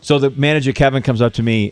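usual speaking voice

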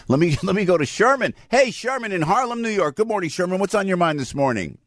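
radio host greeting. Real, not acted. Host takes caller.

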